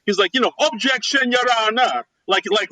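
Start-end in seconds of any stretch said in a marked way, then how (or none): 0.6-2.0 put-on Jamaican accent. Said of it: "really thick Jamaican accent".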